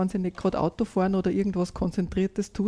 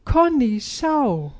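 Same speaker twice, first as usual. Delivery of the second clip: higher, louder, slow delight; delighted boy calling out, higher and louder; 4 semitones higher, 4 dB louder, much slower than usual. A narrator's reading. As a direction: call out in wonder, higher. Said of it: "ruft er entzückt".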